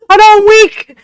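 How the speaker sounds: strained high loud effort; straining. Real, not acted. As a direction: force it out while straining hard. Struggling to open a jar.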